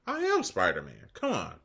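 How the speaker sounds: high, loud playful outrage; mock indignation. Spider-Man fan refusing to miss a game, mock indignant. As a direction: leap up high in hammed-up disbelief.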